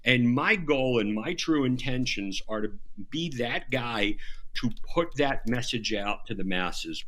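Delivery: ordinary delivery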